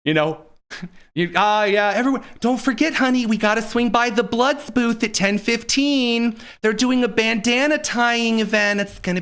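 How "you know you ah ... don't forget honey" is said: ordinary talk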